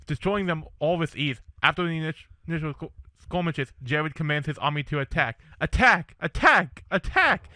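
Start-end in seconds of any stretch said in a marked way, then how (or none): 5.6-7.5 battle cry, loud and rising; shouting. A narrator's reading. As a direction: yell the charge to the army. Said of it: "Jared shouts".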